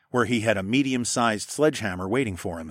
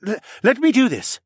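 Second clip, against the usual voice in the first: high, loud urging with a stammered start; pushing, raised-voice appeal; insistent plea; 13 semitones higher, 7 dB louder than usual. Acted. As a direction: argue hard to be allowed. Horace arguing to be allowed.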